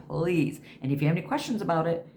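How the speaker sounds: normal talking voice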